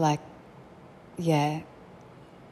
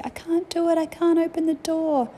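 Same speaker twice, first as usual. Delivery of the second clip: put-on much higher small-child voice, pleading; pretend trapped child; 12 semitones higher than usual. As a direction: play a helpless toddler stuck behind the door. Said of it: "And I'd have to say".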